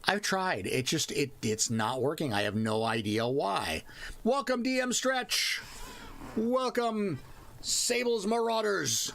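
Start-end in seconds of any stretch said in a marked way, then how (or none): 4.2-9.1 high-pitched, announcer-like greeting; hearty welcome. Real, not acted. Streamer greeting the raiders as they arrive, hearty welcome. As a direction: hail them high and drawn out.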